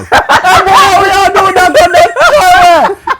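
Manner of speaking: high, loud shouted mimicry; yelling. Someone yelling across the road, loud high mock shout. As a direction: holler it out, hammed up with laughter around.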